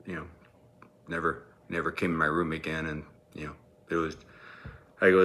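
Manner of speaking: ordinary delivery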